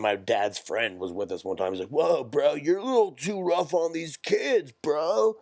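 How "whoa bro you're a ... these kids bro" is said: higher, louder laid-back surfer dude; surfer bro voice. Retold or imitated. Dad's friend telling the father he's too rough on the kids, surfer bro voice. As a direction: drawl it like a chill skater bro. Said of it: "that's literally how he talked".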